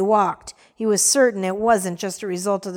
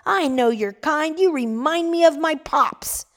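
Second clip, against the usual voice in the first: angry shout; 6 semitones higher than usual. Acted. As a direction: hurl it at the old man. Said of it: "Another voice shouted".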